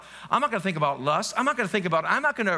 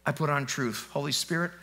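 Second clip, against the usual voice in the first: hushed demo prayer to the Lord; quiet prayer; 6 semitones lower, 4 dB quieter than usual. Shown as a demonstration. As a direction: lower and softer, intimate prayer. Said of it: "So this, say".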